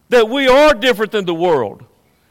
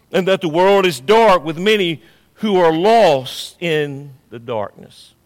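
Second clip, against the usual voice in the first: ordinary delivery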